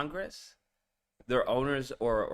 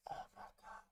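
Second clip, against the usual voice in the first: stunned whisper; 20 dB quieter, much faster than usual. Real, not acted. Stunned at what he heard.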